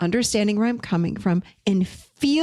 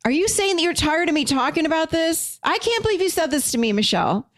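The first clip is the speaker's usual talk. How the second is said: high-pitched, indignant protest; mock-offended friend, voice jumping high; upset friend; 9 semitones higher than usual. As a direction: play a hurt, defensive friend pushing back. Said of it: "If she were to come back to me and say something like".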